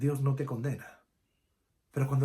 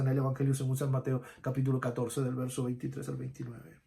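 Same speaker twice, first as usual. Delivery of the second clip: ordinary talk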